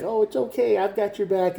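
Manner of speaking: high mock-comforting voice; placating soothing. Reader imitates the viewer calming the partner, high mock comfort. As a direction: pitch it up like you're coaxing a tantrum down.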